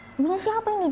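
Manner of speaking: retold high-pitched demand, no real shout; mock yell. Mother demanding to know whose prayer clothes these are, retold mild yell. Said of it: "Dia teriak-teriak".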